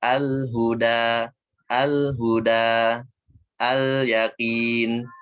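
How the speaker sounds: Al-huda with the l flowing just a little, slowly; correct: lam flows a touch. Shown as a demonstration. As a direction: let the lam of al-huda glide briefly into the next sound. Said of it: "Ngalir sedikit".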